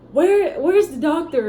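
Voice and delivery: high, loud, slow plea for the doctor; imitating her desperate cry in labor; panicked yell. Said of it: "I was like".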